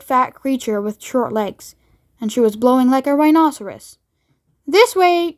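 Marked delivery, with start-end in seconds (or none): 4.7-5.4 higher, louder call; cried out. Acted. Wonka calling the group down the corridor, loud. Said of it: "cried Mister Wonka".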